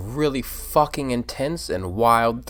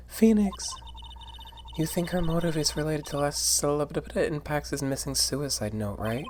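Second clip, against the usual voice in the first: high character voice; 6 semitones higher, 3 dB quieter than usual. Acted. Voicing a character.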